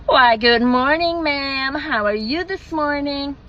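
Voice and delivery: cheery American drive-through server, words drawn out; put-on American accent. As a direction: imitate an American server's slow, sing-song greeting. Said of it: "Sorry, forgive the accent".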